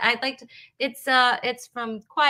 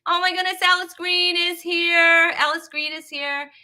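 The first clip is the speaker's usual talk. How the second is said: high, loud, gushing welcome; thrilled announcement; 7 semitones higher, 5 dB louder than usual. Real, not acted. Host spots Alice joining the show, thrilled. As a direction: proclaim a favorite guest's arrival, stretching it out.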